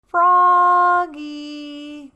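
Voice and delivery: long high call of the name. A narrator's reading. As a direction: call him from across the house. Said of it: "called his mother".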